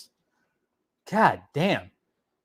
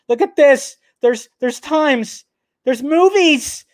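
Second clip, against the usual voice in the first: high, slow, marveling delight; excited; 8 semitones higher, 3 dB louder, much slower than usual. Real, not acted. Streamer finding showtimes at the luxury theater, excited. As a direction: stretch each discovery out in wonder. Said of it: "I'm excited".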